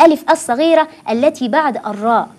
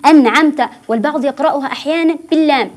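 ordinary delivery

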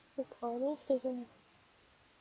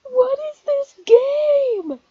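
very loud, high, drawn-out shout; astonished yell; 18 dB louder, much slower than usual. Real, not acted. Streamer stunned by the game, long high yell. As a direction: yell in disbelief, stretching the last word.

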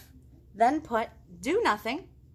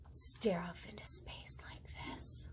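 whisper; 3 semitones lower, 11 dB quieter, slower than usual. Acted. Rabbit demonstrates staring into space.

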